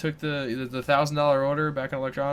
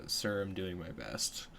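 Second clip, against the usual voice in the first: quiet polite reply; 7 semitones lower, 13 dB quieter, much slower than usual. Retold or imitated. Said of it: "the appropriate response in a workplace".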